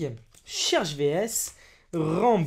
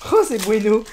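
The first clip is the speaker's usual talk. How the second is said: louder delighted repeat of "c'est bueno"; excited loud; 3 semitones higher, 10 dB louder than usual. Real, not acted. Youtuber calling out his good pull, delighted. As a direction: bueno called out with a grin.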